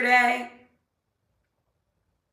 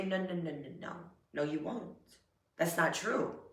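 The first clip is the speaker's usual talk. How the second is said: much softer, calm no no no; soft firm dismissal; 6 semitones lower, 12 dB quieter than usual. Shown as a demonstration. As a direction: quietly shut the doubt down. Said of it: "when self-doubt tries to creep in, say".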